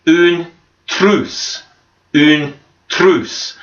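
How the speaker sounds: une trousse with a rolled French R; French pronunciation. Shown as a demonstration. Teacher modelling une trousse for the class to repeat. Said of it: "if you répétez en français".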